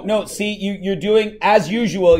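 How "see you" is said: ordinary talk, nothing marked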